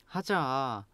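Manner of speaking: pleading. Shown as a demonstration. Teacher modelling tones for learners, pleading. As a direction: beg with a quiet, stretched 하자. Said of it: "the tone change".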